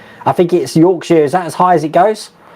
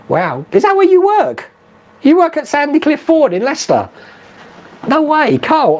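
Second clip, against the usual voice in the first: amazed surprise; 10 semitones higher than usual. Real, not acted. Reads viewer's surprising news.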